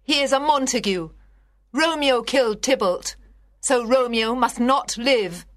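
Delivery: high-pitched, vengeful outcry; outraged demand. Acted. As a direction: demand death for the killer. Lady Capulet demands Romeo's death.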